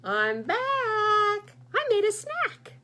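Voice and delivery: loud, jumping very high on made a snack; cheery goose. Acted. Goose returning with snack, loud cheery announcement. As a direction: announce it with a bright squeak.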